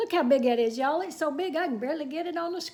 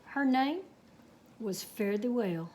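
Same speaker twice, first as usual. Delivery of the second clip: unhurried Texas tall-tale telling; story narration; 7 dB louder than usual. A narrator's reading. Reading a cowboy tale to children, storytelling. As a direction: spin the yarn for the kids.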